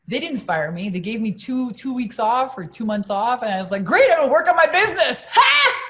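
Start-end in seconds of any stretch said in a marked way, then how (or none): 3.8-5.3 much higher, louder, laughing through it; gleeful laughing reply. Retold or imitated. Saleswoman reacting to her paid time off, laughing glee. 5.3-5.8 very high loud barked ha; triumphant shout laugh. Real, not acted. Woman celebrating her turning point, loud triumphant ha.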